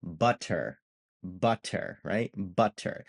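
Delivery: "butter" with crisp T and sounded R; careful "correct" pronunciation. Shown as a demonstration. Teacher shows the standard word, "butter" carefully said with T and R. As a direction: enunciate the T and final R in "butter". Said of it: "say it the correct way".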